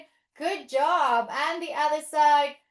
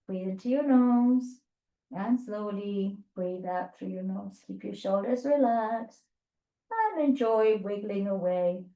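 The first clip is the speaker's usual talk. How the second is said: calming breath guidance; 7 semitones lower than usual. Shown as a demonstration. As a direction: ease them into relaxed breathing. Guiding slow breathing.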